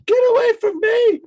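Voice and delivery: shrieking high scream of terror; a victim's shrill scream. Acted. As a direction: scream it as you're cut down. NPC screams while attacked.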